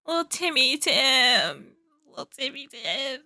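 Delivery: soft, drawn-out coo fading almost away; tiny pitying lament. Real, not acted. Reactor pitying crying Timmy Tim, fading sad coo.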